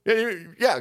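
usual speaking voice

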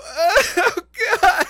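put-on high, loud dismayed oh god from the girlfriend; girlfriend's dismay. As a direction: cry it out high and loud in horror. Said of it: "you just hear her go".

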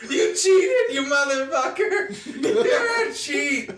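cackling while calling him a cheat; laughing accusation. Real, not acted. Caught cheating at the game.

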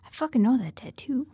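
hushed, voiceless aside; whisper. Retold or imitated. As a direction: whisper it like a secret realization. Zoro recognizing tattoo, whispered.

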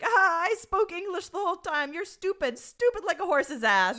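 put-on loud, high drunken rant. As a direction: imitate a drunk man ranting insults. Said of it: "The guy who's drunk on the beach".

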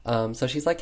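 ordinary talk

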